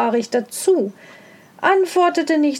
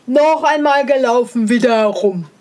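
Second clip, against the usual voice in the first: a call, somewhat louder; hare calling out; 5 dB louder than usual. A narrator's reading. The beaten hare calls for another race, somewhat louder. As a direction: shout it down the field. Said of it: "Er rief".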